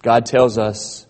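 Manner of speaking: ordinary delivery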